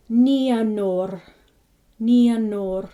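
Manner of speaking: Nianor, final R rolled; NEE-a-nor with trilled R. Shown as a demonstration. Teacher modelling Nianor with a rolled R. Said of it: "R is always rolled".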